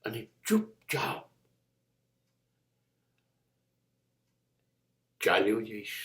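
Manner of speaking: fading farewell. Acted. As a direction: let the voice fade as if leaving the world. Quiet farewell at the monologue's end. (અંતિમ વિદાયનો ધીમો, ઝાંખો પડતો અવાજ)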